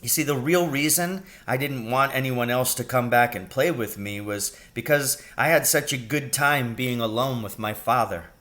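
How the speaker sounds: usual speaking voice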